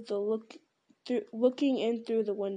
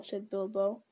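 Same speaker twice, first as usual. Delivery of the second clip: ordinary delivery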